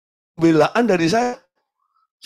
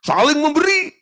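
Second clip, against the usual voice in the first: rising preach; 12 semitones higher, 5 dB louder than usual. Acted. Climactic preaching.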